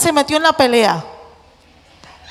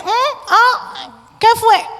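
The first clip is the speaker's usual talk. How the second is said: answering mother; 8 semitones higher, slower than usual. Acted. Mother answering her child.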